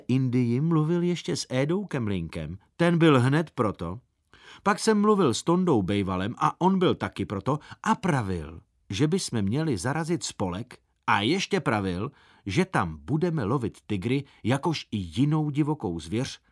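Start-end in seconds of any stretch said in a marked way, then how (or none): none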